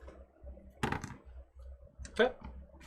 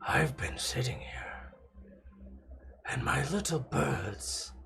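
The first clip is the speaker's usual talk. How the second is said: demonic voice; 6 dB quieter than usual. Acted. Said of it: "just demonic voice of Granasso speak".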